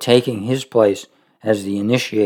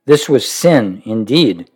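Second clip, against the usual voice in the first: steady measured reading of a devotional book; reading aloud; 4 dB louder than usual. Acted. Preacher reading Roy's book to listeners, measured reading voice. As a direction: read the passage evenly to the congregation.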